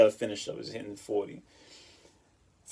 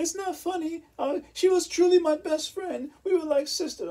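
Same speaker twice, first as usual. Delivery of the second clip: put-on very high, half-crying girly voice; mock sob about the best friend; imitating ex, tearful; 4 dB louder than usual. Ex laments betrayal by friend.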